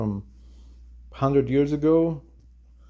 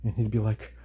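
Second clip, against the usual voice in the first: normal talking voice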